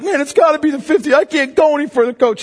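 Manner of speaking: high, desperate protest; retold crying plea. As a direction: plead to the coach as if spent. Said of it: "the kid starts crying".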